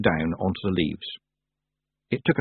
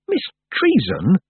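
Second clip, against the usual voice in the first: stern; 10 semitones higher, 3 dB louder, slower than usual. A narrator's reading. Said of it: "said Tiffany sternly".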